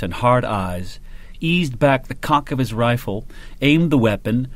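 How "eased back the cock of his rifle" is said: plain reading voice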